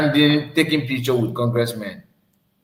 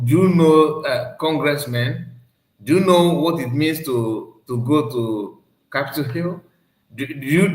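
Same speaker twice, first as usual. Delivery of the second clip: usual speaking voice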